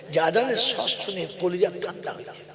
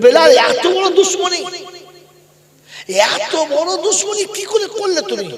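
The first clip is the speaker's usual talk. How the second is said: high, loud chanted cry of reproach; loud, high-pitched pleading accusation; anguished reproach; 13 semitones higher, 11 dB louder than usual. Acted. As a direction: cry it out high, drawing the words out. Prophet reproaches Bilal in dream.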